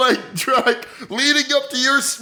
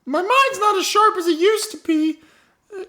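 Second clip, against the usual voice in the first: put-on higher, rambling old woman owning up with a laugh; old lady confessing; 5 semitones higher than usual. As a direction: confess as the doddering grandma, pitched up. Grandma character confesses to murder.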